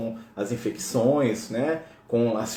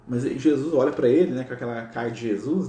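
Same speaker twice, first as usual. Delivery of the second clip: normal talking voice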